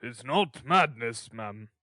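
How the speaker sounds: slow, weighty beadle voice; lightly voiced pompous official; Mister Bumble. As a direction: pronounce it loudly, then drop away on the last word. Beadle pronounces gravely.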